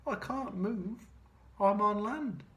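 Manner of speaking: beached whale's mild lament; moaning whale. Acted. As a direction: groan about being stuck. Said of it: "moaned the whale".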